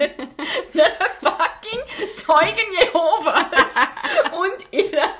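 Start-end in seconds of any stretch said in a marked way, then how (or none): 3.8-4.3 sudden high squeal of laughter. Real, not acted. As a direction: burst out laughing. Laughing at the seat story.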